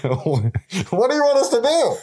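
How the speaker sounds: high, exasperated sailor; mock sailor. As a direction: throw it up high in disbelief. Imitating sailor reply.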